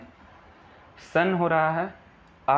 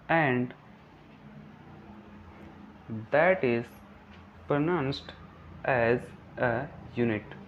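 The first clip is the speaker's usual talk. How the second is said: very slow, broken-up definition reading; slow dictation; much slower than usual. Shown as a demonstration. Teacher reads out a definition for students' notes, slow dictation. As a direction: dictate word by word with long pauses.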